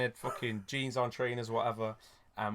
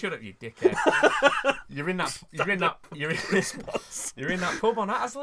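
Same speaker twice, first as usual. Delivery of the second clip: mum scolding, cracking up; 7 semitones higher, 5 dB louder than usual. Retold or imitated. Mum disbelieving on phone.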